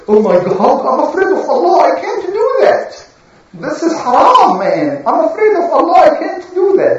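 high, panicky devil voice; mock scared shaitan. Shaitan hearing the evil lesson, high and panicky. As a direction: squeal it like a frightened devil.

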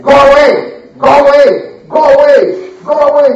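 loud, sharp shout to drive them off; shooing shout; angry go away. Acted. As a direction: bark at the beggars to leave. Locals chase the beggars off.

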